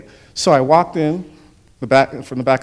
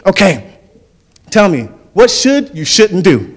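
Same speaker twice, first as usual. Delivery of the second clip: loud, higher-pitched mock coach; pouty-lipped coach impression; 7 semitones higher, 6 dB louder than usual. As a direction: quiz the team as a comic coach, lips stuck out. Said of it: "he'd stick his lips out like this".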